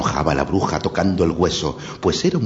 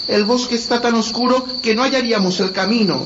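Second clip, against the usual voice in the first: put-on little girl voice. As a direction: speak like a small frightened girl. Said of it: "añadió Gretel".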